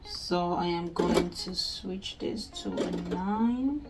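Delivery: ordinary talk, nothing marked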